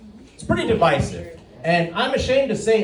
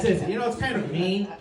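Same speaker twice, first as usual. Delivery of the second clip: ordinary talk, nothing marked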